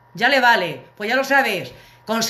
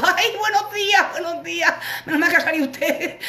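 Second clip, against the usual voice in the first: butter up the doorman, voice pitched way up; high pleading greeting; 9 semitones higher than usual. Retold or imitated. Begging the man at the door.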